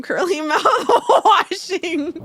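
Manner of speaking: words breaking up into high laughs; talking through laughter. Real, not acted. Joke teller back at the game, helplessly laughing words. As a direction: fight the giggles and lose by the last word.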